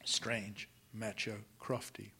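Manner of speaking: a soft, private whisper to herself; whispered aside. A narrator's reading. Narrator musing on kind Crofty in a whisper. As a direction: breathe it out almost voiceless. Narrator's fond closing remark.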